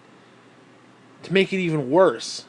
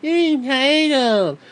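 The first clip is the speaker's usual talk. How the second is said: high, slow mocking sing-song; mocking people; 7 semitones higher, much slower than usual. Ranter mocking critics who call him entitled, high slow sneer. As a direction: parrot the accusers with a sneer. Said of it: "sick and tired of people saying that".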